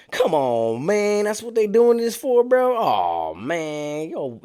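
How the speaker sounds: drawn-out, swooping disbelief; mock exasperation. D learns why they fought, mock exasperation. D's retold reaction to fight news.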